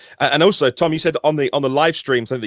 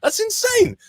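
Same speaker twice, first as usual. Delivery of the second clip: high-pitched incredulous exclamation; mock disbelief; 13 semitones higher than usual. Driver astonished at his own week's mileage, acted disbelief. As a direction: act amazed, pitch jumping right up.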